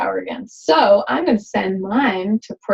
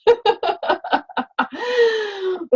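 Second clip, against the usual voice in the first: high bubbling laugh; amused high giggle; 11 semitones higher than usual. Real, not acted. Laughs at turkey idea.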